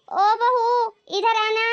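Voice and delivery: imperious household shout; calling out. Acted. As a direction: call her in from another room. Mother-in-law summons daughter-in-law.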